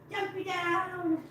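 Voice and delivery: high, soft, drawn-out singsong; coaxing cats. Real, not acted. Owner urging a kitty to jump, slow high coaxing. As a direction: stretch it out sweetly for a pet.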